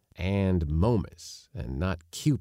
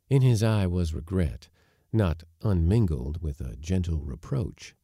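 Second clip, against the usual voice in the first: ordinary delivery, nothing marked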